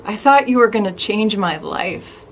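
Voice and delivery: loud, emotional outburst easing off; hurt confrontation. Acted. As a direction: let the hurt out loudly, with halting pauses. Acted hurt confrontation in monologue.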